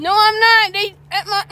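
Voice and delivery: high, loud laughing denial; laughing shout. Real, not acted. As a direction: shout it through giggles. Denying while laughing loudly.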